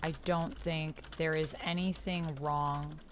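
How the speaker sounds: ordinary talk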